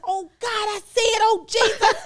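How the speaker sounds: high, loud mock freak-out; shocked outcry. Acting out the assistant seeing the tweet, shocked outcry. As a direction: cry out as it sinks in.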